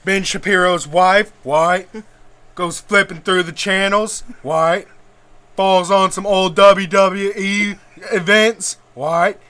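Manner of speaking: loud, swaggering wrestler promo with shouted what? after every line; Stone Cold impression. Podcaster riffs as Stone Cold about Ben Shapiro's wife, loud wrestler promo. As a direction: bark the promo like a beer-chugging wrestler, yelling what? on each pause. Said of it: "it's hard to get a real Stone Cold tangent".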